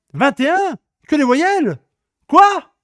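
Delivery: can't believe the answer; indignant disbelief. Real, not acted. Indignation face à la réponse. (incrédule et indigné)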